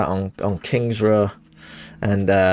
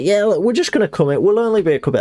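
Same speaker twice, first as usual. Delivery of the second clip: higher, breezy mock landlord voice; imitating the landlord; 10 semitones higher, faster than usual. Retelling the landlord barging in, a higher mock breezy voice. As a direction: brush off the tenant, pitched up high. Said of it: "she was just like".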